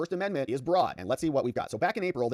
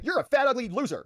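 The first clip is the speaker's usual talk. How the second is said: mock insult; 10 semitones higher, 4 dB louder than usual. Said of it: "go up to somebody and say".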